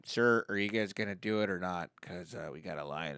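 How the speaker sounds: low, deadpan worker voice; ride attendant. Acted. Ride worker to stalling family, low and deadpan. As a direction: quietly imitate an unbothered employee.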